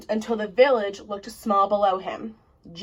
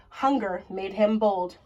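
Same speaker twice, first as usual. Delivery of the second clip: ordinary delivery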